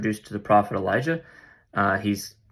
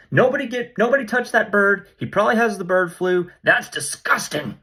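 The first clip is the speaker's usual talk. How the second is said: disgusted alarm; 10 semitones higher than usual. Retold or imitated. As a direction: warn everyone off the gross bird. He pictures warning about the raven.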